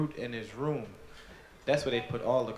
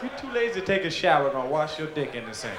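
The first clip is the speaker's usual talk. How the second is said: scolding mother; 2 semitones higher, 4 dB louder than usual. Retold or imitated. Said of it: "my mother walked in the bathroom and said".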